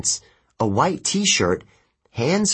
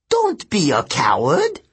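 high, scornful demon; hissing demon; 4 semitones higher, 3 dB louder than usual. Acted. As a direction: goad the reluctant boy like a creature. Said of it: "the first Telekine hissed".

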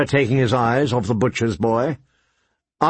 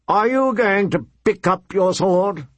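higher, demanding question; menacing prince; 6 semitones higher than usual. A narrator's reading. Wine-wild prince challenges the boy, demanding. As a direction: press him, mildly threatening.

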